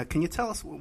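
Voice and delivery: ordinary delivery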